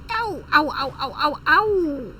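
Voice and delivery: playful yelps of ow; hammed-up cries of pain; mock pain cries. Plane stalling, mock pain cries.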